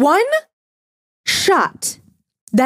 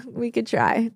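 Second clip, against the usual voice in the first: softly, barely above a murmur; a soft, half-hopeful shrug; quiet; 7 dB quieter than usual. Real, not acted. Quiet mock hopeful repeat.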